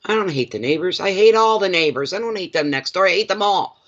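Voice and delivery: imitating a cranky Archie Bunker type; mock hateful grouch. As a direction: play up the blanket dislike of everyone. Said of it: "She was like another Archie Bunker".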